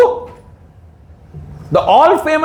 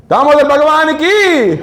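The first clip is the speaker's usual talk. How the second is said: joyful call; 6 semitones higher than usual. Retold or imitated. Devotees cheering joyfully at Krishna.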